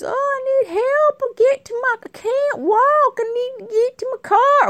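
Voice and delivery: hammed-up damsel begging strangers for help. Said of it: "or whatever she said".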